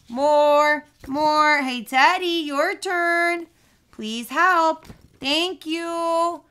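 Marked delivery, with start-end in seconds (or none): none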